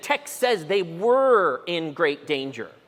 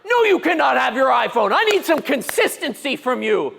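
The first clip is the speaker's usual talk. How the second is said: put-on high, loud shout at the child; father yelling at his daughter; stern yell; 7 semitones higher, 4 dB louder than usual. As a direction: shout her down. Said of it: "And I'm like".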